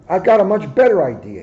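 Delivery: crafty. A narrator's reading. Hare slyly tempts Muggs into a race, quick and crafty. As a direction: sell the trick with a grin. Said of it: "he said craftily".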